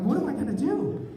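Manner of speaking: high, loud panicked cry; freaking out. Retold or imitated. Trapped driver freaks out with a loud cry. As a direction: panic at the wheel. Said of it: "I start to freak out".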